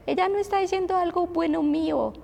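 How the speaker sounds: high whiny child voice, imitated; queja de niña. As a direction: mimic a daughter tattling on her sister. Imita la queja de una hija. (voz aguda de niña quejándose)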